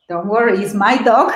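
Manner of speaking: normal talking voice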